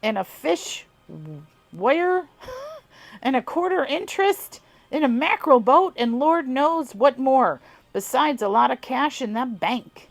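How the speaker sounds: put-on amateur southern accent on an old farmer's tale; southern twang. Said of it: "forgive my god-awful accent".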